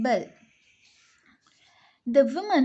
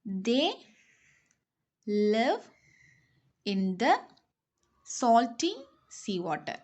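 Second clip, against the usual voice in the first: slow, word-by-word dictation with long pauses. Shown as a demonstration. Teacher dictating each word slowly to the class. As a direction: spell it out slowly for pupils to write. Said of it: "teacher dictating word by word".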